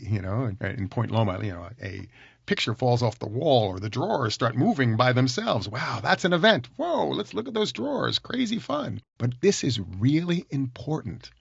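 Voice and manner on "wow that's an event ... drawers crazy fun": high-pitched, wowed exclamations; mock-amazed, pitched well up; amazed audience reaction. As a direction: play a thrilled theatregoer marvelling at a stage trick.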